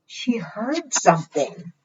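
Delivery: ordinary delivery, nothing marked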